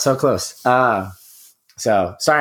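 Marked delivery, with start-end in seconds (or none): 0.0-1.1 clearing a tickly throat mid-sentence; coughing fit. Real, not acted. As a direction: talk through a cough, throat clogged. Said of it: "I'm coughing a lot".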